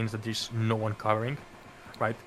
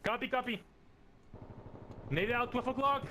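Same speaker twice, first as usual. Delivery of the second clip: radio callout; 12 semitones higher, 6 dB quieter than usual. Shown as a demonstration. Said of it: "You're gonna repeat that?".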